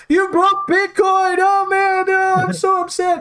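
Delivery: very high, hammed-up mock sobbing; mock crying whine. Mocking a crying trader who lost on Bitcoin, high fake sob. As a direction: whine like a grown man crying online. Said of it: "he actually started crying".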